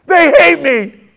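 high, loud, drawn-out complaint; aggrieved shout. Acted. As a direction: wail it as an outraged victim.

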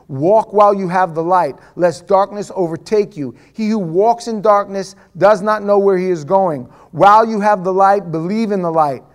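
loud, declamatory Bible reading; forceful scripture reading. Real, not acted. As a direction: proclaim the passage with full voice. Reads scripture aloud with force.

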